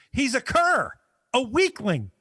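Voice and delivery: high, scornful hero sneer; haughty movie line. Retold or imitated. Young ninja sneering at his master, high scornful. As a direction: look down on him with contempt. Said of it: "Storm Shadow Junior turns to Master and says".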